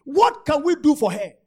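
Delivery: loud, eager question; eager call. Acted. Elisha asks Gehazi eagerly, loud. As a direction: Elisha pressing to help.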